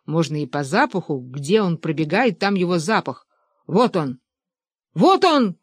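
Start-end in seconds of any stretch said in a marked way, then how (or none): 4.9-5.6 high, sudden hunting cry; excited shout. A narrator's reading. Benedikt spots the prey and pounces, excited shout. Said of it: "крикнул Бенедикт".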